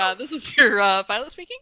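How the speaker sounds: lightly voiced airline pilot; a hint of a captain's cabin announcement; pilot cockpit headset. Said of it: "the pilot voice".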